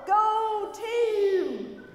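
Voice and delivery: loud "Go team!" yelled; cheer yell. Acted. Pastor yelling the cheer with the kids, loud. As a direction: shout "Go team!" as a rallying cry. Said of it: "that was pretty lame".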